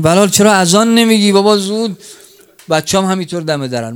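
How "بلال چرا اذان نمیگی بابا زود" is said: impatient call. Acted.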